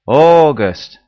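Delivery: loud chorus "August.", falling; group repeating after leader. Pretend. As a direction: class roars it back together.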